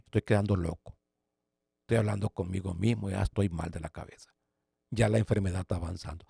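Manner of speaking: low, very soft self-talk; abatido, para sí. Retold or imitated. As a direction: retell a despairing thought under your breath. Se habla a sí mismo, llorando, abatido. (hablando consigo mismo, bajo y abatido)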